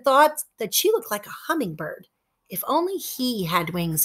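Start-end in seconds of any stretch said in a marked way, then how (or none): 2.5-4.0 wistful. A narrator's reading. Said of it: "he thought wistfully".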